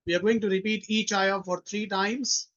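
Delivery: normal talking voice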